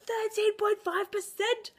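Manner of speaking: high, slow exclamation ending in a loud gasp; exaggerated astonishment, drawn out; mock shock. Reading the wine label aloud, mock shock.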